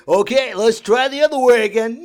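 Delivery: loud, high PA shout; announcer shout. Retold or imitated. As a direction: bellow the next order. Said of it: "then we had to go".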